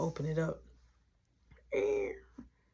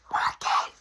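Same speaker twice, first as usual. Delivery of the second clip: urgent voiceless call; acted scared whisper-call. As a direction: hiss the name in fright. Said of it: "to the point I'm scared".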